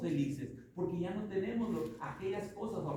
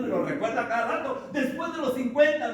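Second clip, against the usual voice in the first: laughing; 6 semitones higher, 7 dB louder than usual. Real, not acted. Laughing about turning fifty.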